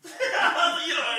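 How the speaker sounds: high, loud burst of laughter. Real, not acted. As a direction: crack up loudly.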